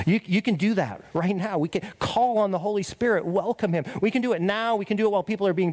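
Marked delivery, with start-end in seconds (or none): none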